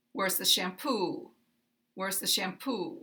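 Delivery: Where's the shampoo said with a glide down at the end; falling intonation. Shown as a demonstration. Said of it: "make this a glide down".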